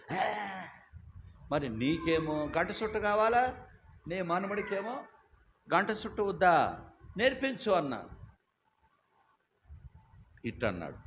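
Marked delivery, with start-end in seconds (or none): 0.1-7.7 high, loud incredulous scolding rising to a peak; mock rebuke. Preacher scolding the pastor over his grandson, high and incredulous. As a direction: scold him in disbelief, climbing higher.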